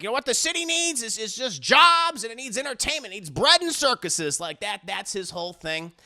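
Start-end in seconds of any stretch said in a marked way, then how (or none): 0.0-4.4 lightly mocked rabble-rousing mayor, pitched high; politician's mock stump speech, stretching the key word; populist mayor. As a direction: pitch it up like a politician working a crowd. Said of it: "he's this populist mayor, and he's just like".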